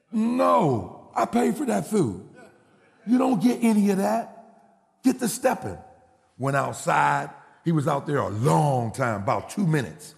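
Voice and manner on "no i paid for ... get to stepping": high-pitched father laying down the law; acted parental indignation; indignant refusal. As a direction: send him packing, pitched up. Father refuses food, orders son out.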